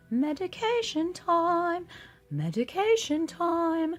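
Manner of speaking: loud, high, drawn-out call; Nurse Ratched. Retold or imitated. Nurse calling patients for their pills, loud slow call. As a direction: announce it like a stern ward nurse. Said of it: "That's Nurse Ratched".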